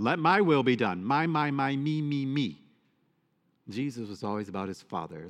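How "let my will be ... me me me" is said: selfish demand. Retold or imitated. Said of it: "we want our will done".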